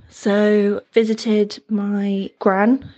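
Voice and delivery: ordinary talk, nothing marked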